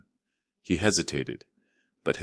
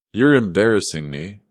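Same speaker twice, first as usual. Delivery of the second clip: louder, hurt and angry; loud protest; 4 semitones higher, 8 dB louder than usual. A narrator's reading. Daughter scolding her father at the door, loud and upset. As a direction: snap it out in embarrassment.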